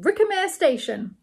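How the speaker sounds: high, loud station call; porter's shout. A narrator's reading. Porters announce the station, high loud call. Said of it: "and the porters shouted".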